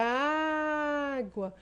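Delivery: água with a long, loud, high first A; stress on the first A of água. Shown as a demonstration. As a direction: hold and belt out the opening A of água. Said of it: "a letra A possui a maior intensidade fonética".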